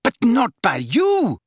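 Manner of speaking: loud, high retort; high-pitched, heated accusation; furious. A narrator's reading. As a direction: hurl it back angrily. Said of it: "said Bellatrix furiously".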